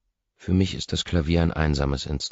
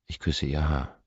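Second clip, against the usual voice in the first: ordinary delivery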